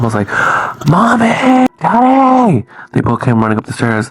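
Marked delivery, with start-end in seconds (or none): 0.9-2.6 put-on high-pitched child's cry for parents; calling out. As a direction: call out drawn-out for help, imitating a child. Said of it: "I called out for my mom".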